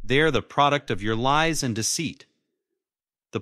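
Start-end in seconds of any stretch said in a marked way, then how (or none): 0.1-2.3 loud, high-pitched accusation; raised-voice bitter retort; shot back. A narrator's reading. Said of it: "I shot back".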